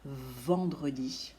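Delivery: "Vendredi" with a long buzzing "Vvv" at the start; "Vendredi" slowed, its first consonant held; exaggerated first sound. Shown as a demonstration. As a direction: stretch the V opening "Vendredi", slow and low. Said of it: "d'exagérer le début".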